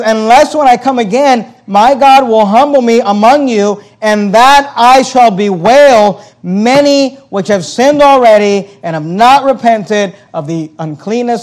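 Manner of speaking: loud, high, slow fiery preaching; preaching at full force. Acted. Pastor preaching Paul's warning, loud, slow and fiery. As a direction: thunder the verse at the congregation.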